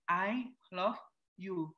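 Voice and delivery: gentle, hushed I love you; soft love whisper. Retold or imitated. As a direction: say it softly across the distance. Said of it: "cuma pakai simbol". (ucapan cinta lembut dan pelan)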